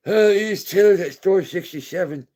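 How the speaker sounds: lightly voiced old man with a walker; old guy on Discord. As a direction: play the eager old-timer reporting in.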